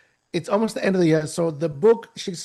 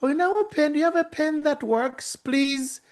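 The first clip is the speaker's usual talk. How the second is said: much higher, sugary request; sweet asking; 10 semitones higher than usual. Retold or imitated. Clerk asking her friend for a working pen, higher and sweet. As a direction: ask sweetly in a high voice. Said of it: "reaches over to her friend and says".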